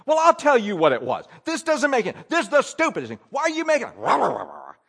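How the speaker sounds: loud, high-pitched angry rant; disputing. Acted. Employee challenging the boss to his face, disputing loudly. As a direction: blow up in a hammed-up tirade. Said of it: "now we are disputing".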